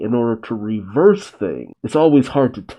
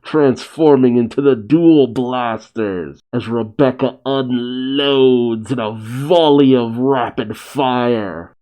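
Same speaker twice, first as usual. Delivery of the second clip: ordinary delivery, nothing marked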